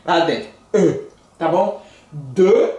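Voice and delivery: normal talking voice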